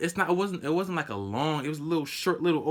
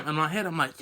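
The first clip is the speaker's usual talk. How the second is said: ordinary talk, nothing marked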